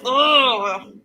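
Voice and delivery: loud, high groan of dread; disgusted groan. Real, not acted. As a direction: groan out a big "ugh" of revulsion. Reacting to real exorcisms.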